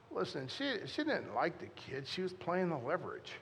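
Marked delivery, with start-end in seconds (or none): none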